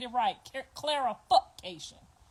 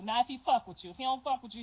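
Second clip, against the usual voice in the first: ordinary delivery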